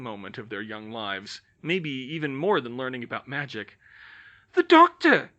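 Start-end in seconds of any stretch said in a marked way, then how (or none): 4.5-5.3 high, loud, thrilled outburst; a child cheering her hero; excited cry. Acted. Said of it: "Karen cried".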